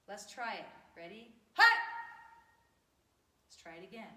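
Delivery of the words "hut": short explosive martial arts shout; kiai shout. Shown as a demonstration. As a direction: belt it from the gut. Karate drill, shouted kiai.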